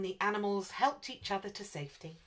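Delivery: usual speaking voice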